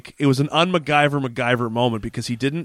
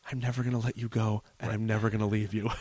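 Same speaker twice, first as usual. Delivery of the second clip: much softer, acted submission; hushed promise; 3 semitones lower, 10 dB quieter than usual. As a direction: imitate her quiet vow to stay. Acting out the wife's submission.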